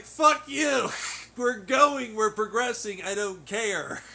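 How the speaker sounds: angry retort. Retold or imitated. Telling off the people who held him back, angry shout. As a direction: yell it at them, then slow down for the last bit. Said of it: "I was just so pissed".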